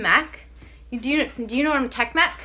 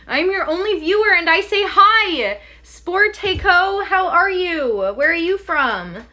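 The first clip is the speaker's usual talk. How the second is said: perky chat greeting; 6 semitones higher, 7 dB louder than usual. Pretend. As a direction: greet the viewer brightly.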